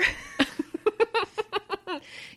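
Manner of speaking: high-pitched giggle. Real, not acted. Laugh at own answer.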